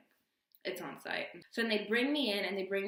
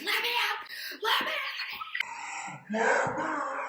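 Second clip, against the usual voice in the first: high, loud, drawn-out scream; hammed-up desperate yell through cell bars; losing it, screaming; 14 semitones higher, 6 dB louder, much slower than usual. Jailed girl begging officers from the bars, hysterical screaming. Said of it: "losing my shit, and I'm on the freaking bars".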